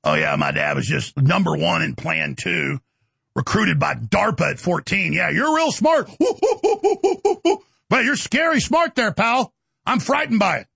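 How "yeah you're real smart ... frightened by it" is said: sarcastic. Real, not acted. Sarcastic jab at opponents.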